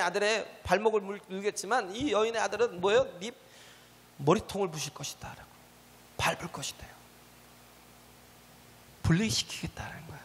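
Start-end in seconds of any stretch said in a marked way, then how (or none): 4.2-10.2 hushed whispered promise. Acted. Preacher whispers God's promise to the congregation. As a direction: drop to a breathy secret whisper.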